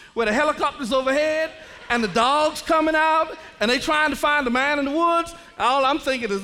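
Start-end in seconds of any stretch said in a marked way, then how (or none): none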